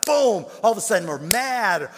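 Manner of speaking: high loud preacher punch; booming build. Acted. Preacher booming about sudden anger and fear. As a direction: hit it hard like a sudden blow.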